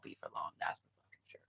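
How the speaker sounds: a cold whispered promise; whispered threat at the rival woman, menacing. Acted. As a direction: hiss the threat under your breath.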